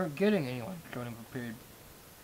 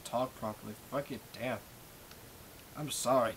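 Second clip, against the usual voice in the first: ordinary talk, nothing marked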